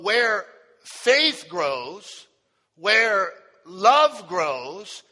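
slow, emphatic drawn-out refrain; preached refrain. Real, not acted. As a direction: stretch each key word for the congregation. Preaches the title refrain once more.

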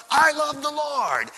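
an excited outburst of love; excited blurting. Retold or imitated. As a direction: blurt out your love for the Lord. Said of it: "so moved ... blurting out".

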